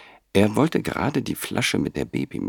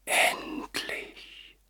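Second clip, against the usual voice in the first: soft breathy whisper of relief; 7 dB quieter than usual. Acted. Grandfather to sleeping baby, whispered. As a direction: barely breathe the word out. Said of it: "flüsterte er".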